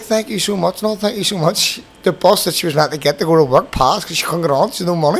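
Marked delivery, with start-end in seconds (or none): 0.0-0.8 grateful woman. Retold or imitated. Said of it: "She was like". 0.8-1.8 grateful woman. Retold or imitated. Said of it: "She was like".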